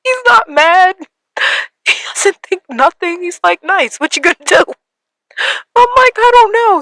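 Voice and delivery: words pushed out through crying; teary, high voice. Real, not acted. Telling her audience about her brother's reaction, voice high with tears.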